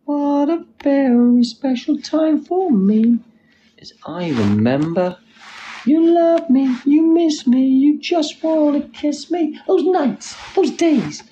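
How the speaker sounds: very high, drawn-out play-acting voice; high put-on voice. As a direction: pitch it way up and stretch the phrases. Puts on a high squeaky character voice.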